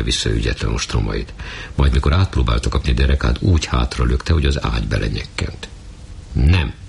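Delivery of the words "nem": a hard, sharp no; firm refusal. Acted. Anna firmly refuses Jancsi's advances with a hard no. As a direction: shut him down flatly. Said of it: "szólt keményen".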